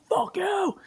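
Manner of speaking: mock yell, strained and squeezed high; angry yelling driver. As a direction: imitate an enraged motorist's shout without full volume. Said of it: "he's yelling at me".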